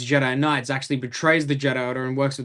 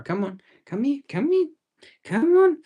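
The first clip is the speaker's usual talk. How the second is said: high sing-song puppy talk; coaxing the dog; 11 semitones higher than usual. Real, not acted. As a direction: coo the dog over toward the camera.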